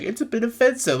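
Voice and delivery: high, mocking sing-song imitation. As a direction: mimic her, a mocking high register. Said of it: "she's like".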